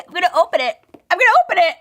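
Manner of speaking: giddy rising squeal; building excitement. Real, not acted. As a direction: wind yourself up to open it. Building excitement opening box.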